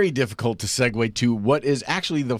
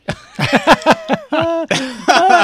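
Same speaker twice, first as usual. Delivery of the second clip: loud high laugh; 9 dB louder than usual. Real, not acted. Laughs at autopilot joke.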